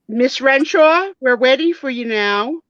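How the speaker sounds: high, loud waiting-room call; nurse calling patient. Acted. Nurse summons Jody from the waiting room, high sing-song. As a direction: chirp the patient's name out. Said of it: "This is Nurse Christina Taylor".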